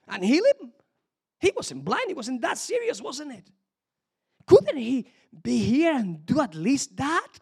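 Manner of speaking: high-pitched sceptical complaint; mock-incredulous bystander; grumbling onlookers. Acting out grumbling mourners at tomb.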